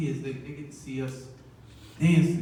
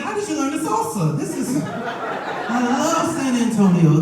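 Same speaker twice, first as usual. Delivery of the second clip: much higher, laughing; mock amazement; 9 semitones higher, 5 dB louder than usual. Father marveling at his son's salsa moves, high and laughing. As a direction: gush over the salsa moves.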